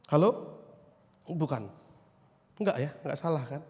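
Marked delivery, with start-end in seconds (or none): none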